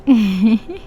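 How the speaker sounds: quick rising laugh; a short laugh to herself. Acted. Girlfriend reacts with a laugh. (maikling tawa)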